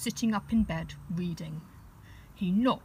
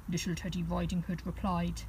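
ordinary delivery, nothing marked

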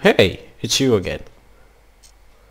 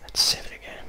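whispering; 9 dB quieter, faster than usual. Real, not acted.

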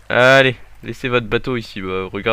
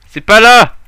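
much higher, loud outburst; frustrated shout; 9 semitones higher, 13 dB louder, slower than usual. Real, not acted. Gamer shouting at the screen when the way is wrong. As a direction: yell it out in frustration.

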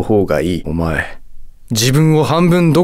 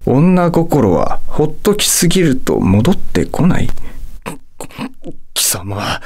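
ordinary talk

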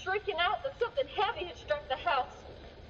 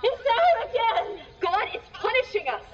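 terrified shout; 6 semitones higher, 7 dB louder than usual. Acted. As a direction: scream the warning in terror. Panicked villager announces monster.

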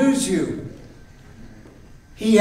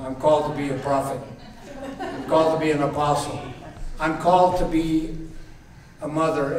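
low, quick grumble of an excuse; mock a reluctant volunteer, low and softer; excuse-making; 5 semitones lower, 5 dB quieter, faster than usual. Church member dodging a call to serve, making excuses. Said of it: "all kinds of excuses".

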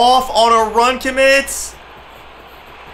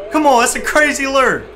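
normal talking voice

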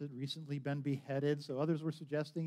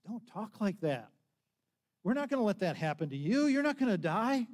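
plead, then push it up and louder; protesting; 5 semitones higher, 5 dB louder than usual. A narrator's reading. Peter objecting as Jesus speaks of his death, building louder.

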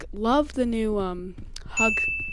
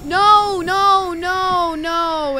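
panicked cries, fading down; 7 semitones higher, 9 dB louder, much slower than usual. Real, not acted. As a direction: start in a high panic, then trail off. Panics as monsters swarm.

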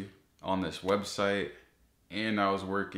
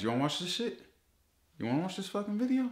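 mock taunt; 9 semitones higher, much faster than usual. Mocking ad bullies.

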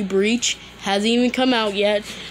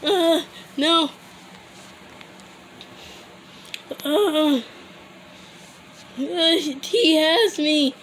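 terrified horror victim; 7 semitones higher, slower than usual. Acted. Caught by the zombie.

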